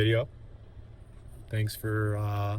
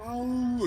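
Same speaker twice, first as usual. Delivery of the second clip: short high moaning oh, much higher; imitated dog moan; 13 semitones higher than usual. Dog comes right up to the couple, high moaning oh. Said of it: "just moaning".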